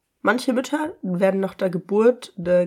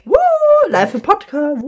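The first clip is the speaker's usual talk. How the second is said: excited cheer; 10 semitones higher, 10 dB louder than usual. Real, not acted. Cheering for BeReal live.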